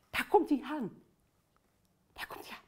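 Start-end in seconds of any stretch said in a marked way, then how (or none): 2.1-2.6 breathless whisper of suspense. Shown as a demonstration. Leader whispers to the kids before they all shout. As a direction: whisper it as he comes near. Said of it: "Kom, we gaan roepen".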